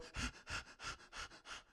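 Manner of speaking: soft unvoiced panting exhale; demonstrating shallow stressed breathing; panting breath demo. Shown as a demonstration. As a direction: breathe out hard like a panicked person. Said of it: "when you go".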